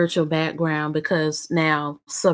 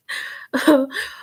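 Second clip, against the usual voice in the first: short laugh; 9 semitones higher than usual. Real, not acted. Laugh after the comment.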